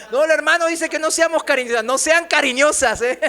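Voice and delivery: high, loud, laughing mimicry; mock indignant outcry. Preacher mimics women misreporting his advice, loud mock protest. Jokes about women misquoting him.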